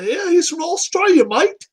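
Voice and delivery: high, hammed-up Australian accent; mock Aussie accent. Host mocking the caller's origin in an Australian accent. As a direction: sell the Aussie bit playfully.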